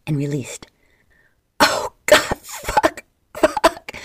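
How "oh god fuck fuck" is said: much higher, loud pained scream. A narrator's reading. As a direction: let the pain rip out at full voice. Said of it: "Michael screamed".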